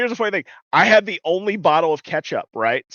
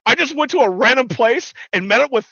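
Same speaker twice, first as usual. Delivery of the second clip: high, loud, breakneck gushing; hammed-up fanboy excitement; imitated excitement; 10 semitones higher, 5 dB louder than usual. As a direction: retell it as a thrilled friend, pitch way up. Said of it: "get more excited in my life".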